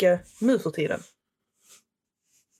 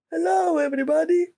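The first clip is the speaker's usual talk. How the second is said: higher, bouncy cartoon doctor hello; Dr. Nick impression; 8 semitones higher than usual. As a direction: pitch it up like a goofy doctor. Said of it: "Hi, doktor Nick".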